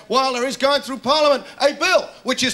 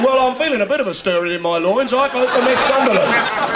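hammed-up caller, louder towards the end; mock phone-in caller; 3 semitones higher, 4 dB louder than usual. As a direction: play a phone-in voter, slowing on the verdict. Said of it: "They should have a phone in".

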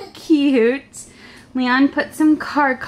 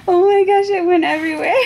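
louder, high-pitched exclamation; delighted alarm, pitched up; amused surprise; 6 semitones higher, 3 dB louder, faster than usual. Real, not acted. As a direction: exclaim at an unexpected mess. Confetti scatters, she is startled.

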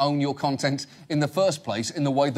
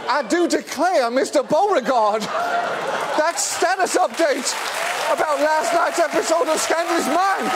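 Southern debutante; 12 semitones higher, 3 dB louder than usual. Acted. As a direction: ham up a swooning debutante. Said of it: "in the voice of a Southern debutante".

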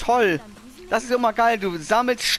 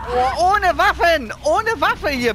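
outraged cry; 6 semitones higher, 3 dB louder, slower than usual. Real, not acted. Suddenly weaponless facing enemies.

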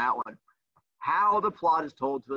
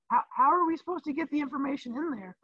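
mock student; 10 semitones higher than usual. Teacher voices complaining student.

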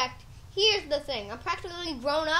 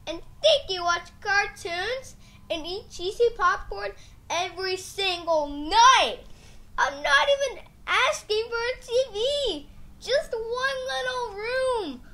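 higher, whining protest; whiny complaint; 5 semitones higher, 4 dB louder than usual. Acted. Child complaining about the dog.

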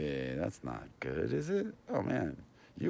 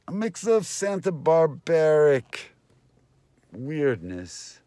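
radio announcer; 7 semitones higher, 8 dB louder, slower than usual. Acted. Radio sign-off continues.

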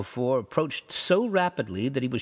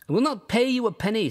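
higher, firm refusal; defiant refusal; 9 semitones higher than usual. A narrator's reading. Rugg refusing the toll gatherer, defiant. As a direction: flatly refuse to pay.

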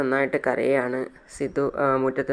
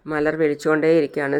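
plain reading voice

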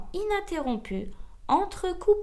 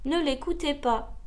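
usual speaking voice

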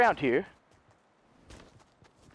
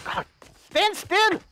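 yelling; 8 semitones higher than usual. Real, not acted. Yelling at enemy vandal.

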